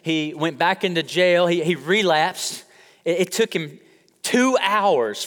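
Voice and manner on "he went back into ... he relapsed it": ordinary delivery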